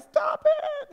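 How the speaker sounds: very high, squealed protest; mock shriek. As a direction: squeal it like a picked-on kid. Picked-on goth kid protesting.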